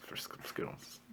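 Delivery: breathy conspiratorial whisper; a hushed aside. Real, not acted. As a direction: tempt him under your breath. Whispered temptation.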